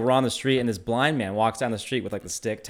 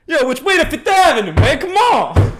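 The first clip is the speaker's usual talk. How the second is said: high, loud call for directions; street holler; 9 dB louder than usual. Retold or imitated. Host replays the blind man calling for directions, loud. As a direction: shout it down the avenue.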